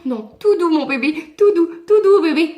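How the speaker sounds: high, loud, frantic tout doux; begging the horse to calm down; scared rider soothing her horse, high and loud; panicky soothing. Retold or imitated.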